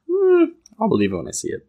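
high, doubting mock voice; lightly mocking disbelief; skeptical quote. Skeptical listener voiced in retelling.

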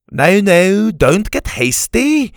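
put-on high, loud, drawn-out old Scot; slow them down like a crotchety Scot; crotchety Scotsman, placating. Story's crotchety old Scottish man.

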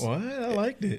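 high-pitched, incredulous objection; surprised protest. Real, not acted. Host defending Savannah to a guest who trashed it, high surprised protest.

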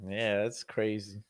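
ordinary delivery

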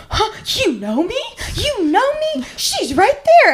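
unable to believe she knows him; stunned excitement. Retold or imitated. Star-struck fan, disbelieving, pointing.

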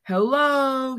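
high loud call into a cave; shouting hello. A narrator's reading. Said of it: "he shouted".

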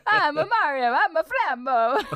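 high, loud hammy cartoon Italian; bouncy video-game plumber voice through laughter; Mario. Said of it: "It's like Mario".

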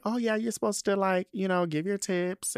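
lightly voiced drag mother; mock drag mother. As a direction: play her up high and breezy.

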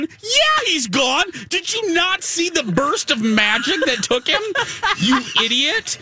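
high-pitched exasperated rant; hammed-up yelling at the screen; mock outrage. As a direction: berate the clueless character in disbelief. Re-enacts yelling at film character.

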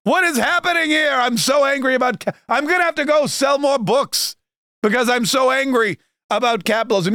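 hammed-up fury at the dinner bill; high cartoonish Bernie rant; Bernie Sanders shouting. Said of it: "He goes".

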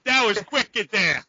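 slow, thick New England "wicked"; New England accent. Retold or imitated. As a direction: imitate a New England grandpa. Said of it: "That's how he talks".

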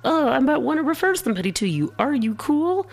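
high, bubbly excited gush; gushing fan voice. Retold or imitated. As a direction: squeal it like an eager fan. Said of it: "I'm like".

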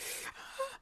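high, quick panicked intake of breath; nervous gasp. Acted. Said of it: "I'm probably gonna be like".

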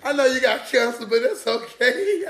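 hammed-up consoling that slows into cackling; mock sympathy into laughter. Acting out laughing at crying person.

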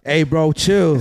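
laughing fake protest; frat bro. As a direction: bro pleading through a laugh. Faking getting punched.

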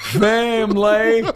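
imitating a Southern drawl, slow and amused; a laughing Southern-accent send-up of the coach; slow mock Southern drawl. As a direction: stretch out "Family" slowly in a put-on Southern accent, laughing. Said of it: "that Cajun drawl from".

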